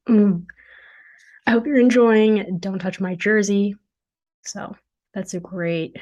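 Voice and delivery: ordinary talk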